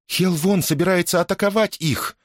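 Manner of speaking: high-pitched urgent exclamation; exclaiming. A narrator's reading. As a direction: cry it out, pitch held high. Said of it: "воскликнула Лиана".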